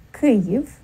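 Kyiv said slowly in two syllables, a short i then yiv; Ukrainian pronunciation. Shown as a demonstration. Teacher showing listeners the proper pronunciation, Kyiv in careful Ukrainian form. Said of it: "the proper pronunciation, um, would be".

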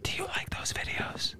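slow airy whisper. Real, not acted. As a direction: whisper it slowly. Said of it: "we're whispering into the mics".

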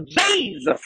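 bigger, drawn-out Southern twang. Retold or imitated. Pastor imitating how people say it, hammed-up Southern twang. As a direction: exaggerate the Southern twang, stretching the first vowel. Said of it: "I love that Southern twang".

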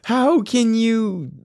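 high, drawn-out disbelief; acted bewilderment. Asking girlfriend how she could, high and bewildered. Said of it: "bewilder me".

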